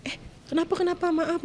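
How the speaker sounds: high, startled questioning, re-enacted; mother jolted awake and apologising; retold startled alarm. Startled mother answering child's cry.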